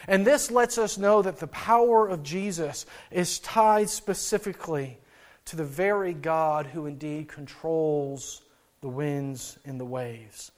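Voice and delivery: ordinary delivery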